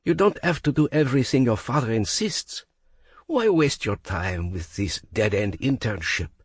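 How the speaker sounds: put-on a tipsy Frenchman flirting in a French accent; drunk French accent. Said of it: "slurs his thick French accent".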